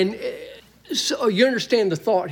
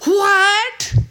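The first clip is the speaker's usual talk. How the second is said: high, loud, outraged What; emphatic What; 12 semitones higher, 5 dB louder than usual. Shown as a demonstration. Paul rejecting the Corinthians' conduct, a high loud emphatic What. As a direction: fling it out as a shocked objection. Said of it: "emphatically say it in the Greek text".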